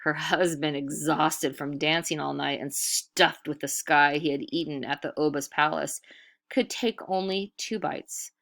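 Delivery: usual speaking voice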